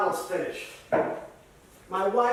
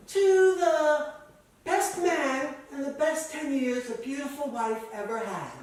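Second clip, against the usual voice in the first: higher-pitched voice imitating a wife toasting; wife's toast; 7 semitones higher, 4 dB louder than usual. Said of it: "And she said".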